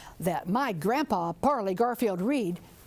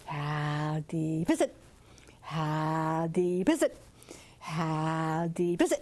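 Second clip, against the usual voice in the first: lower, slow croaked question; deeper frog talk call; 6 semitones lower, slower than usual. Acted. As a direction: croak it slow toward the bigger frogs. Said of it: "call to the bigger frogs".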